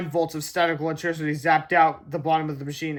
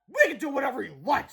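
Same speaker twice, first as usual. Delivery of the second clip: snarling; 9 semitones higher than usual. Acted. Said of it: "snarled Mister Meaner".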